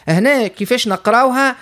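ordinary delivery

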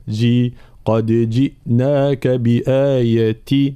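قد جئناك بآية recited slowly with a full i after ج; measured correct recitation; clear kasra. Shown as a demonstration. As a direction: isolate جي, then keep that i in جئناك. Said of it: "لا".

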